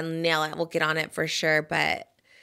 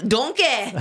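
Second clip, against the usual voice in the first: higher-pitched mock Scottish ogre accent; Shrek; 3 semitones higher than usual. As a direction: exaggerate a cartoon Scottish accent. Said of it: "Is Shrek Scottish?".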